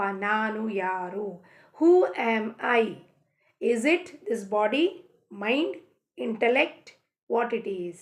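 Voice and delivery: very slow, musing questions; slow contemplation. Acted. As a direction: let each word hang, pondering. Contemplative self-inquiry. (ನಿಧಾನವಾಗಿ ಧ್ಯಾನದಂತೆ ಕೇಳುವ ಪ್ರಶ್ನೆ)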